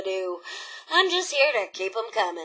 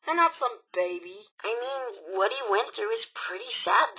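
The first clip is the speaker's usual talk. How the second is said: ordinary talk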